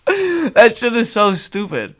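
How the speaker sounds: ordinary talk, nothing marked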